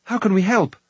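high, urgent offer; exclaiming. A narrator's reading. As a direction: ask eagerly, ready to act. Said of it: "Taran exclaimed".

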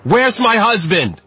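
high, drawn-out desperate shout; shouted demand. A narrator's reading. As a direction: cry out for an answer about a loved one. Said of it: "she shouted".

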